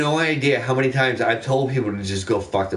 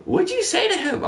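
high mock boss voice. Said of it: "And she's like".

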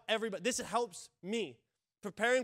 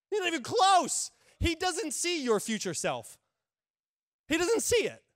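worked up; 8 semitones higher, 7 dB louder than usual. Real, not acted. Pastor worked up over the devil's limits, high and loud. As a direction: pitch high, loud, insistent.

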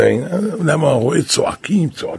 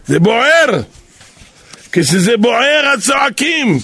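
mock shout; 8 semitones higher than usual. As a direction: cry out בוער as if it really burns. The Saraf explains the shouting.

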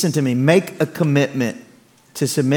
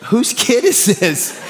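slow then laughing, higher disbelief; amused disbelief; 7 semitones higher, much slower than usual. Retold or imitated. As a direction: marvel at the swapped kid. Stunned by son's good behavior.

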